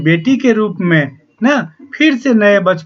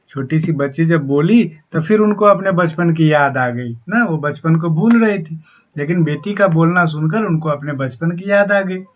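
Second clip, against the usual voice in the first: usual speaking voice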